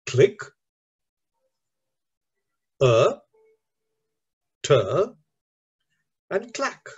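long pauses between click, er, ter and clack; teacherly sounding-out, one syllable at a time. Shown as a demonstration. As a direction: break the word into four separate beats. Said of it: "four syllables".

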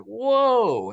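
high, loud gasp of wonder; a stunned, overwhelmed whoa; retelling being blown away; awed whoa. Retold or imitated. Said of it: "I was kinda losing it".

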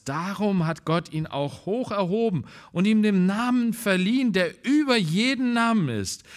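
loud, rising proclamation of scripture; preaching at full force. Acted. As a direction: proclaim it to the whole church.

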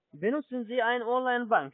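ordinary talk

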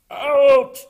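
very loud, high bellow; umpire's shout. A narrator's reading. The ump calls Froggy out, huge loud shout. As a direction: shout the call across the field. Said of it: "cried the ump".

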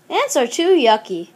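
usual speaking voice

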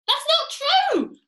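very high, loud, drawn-out protest; distressed cry. A narrator's reading. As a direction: wail the denial in mock panic. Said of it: "cried Missus Twit".

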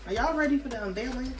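usual speaking voice

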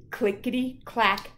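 loud, lively storybook read; picture book read to children. Acted. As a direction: read aloud to the class with gusto. Reads picture book aloud.

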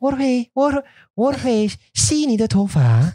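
high, slow Chinese with an Edinburgh lilt; Chinese in Edinburgh accent. Shown as a demonstration. Helper offering a hair wash, Chinese in Edinburgh accent. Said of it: "doing Chinese in a Scottish accent".

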